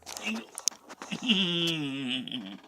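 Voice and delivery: quick amused chuckle; short laugh. Real, not acted. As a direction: laugh at your own slip. Laugh after flubbed line.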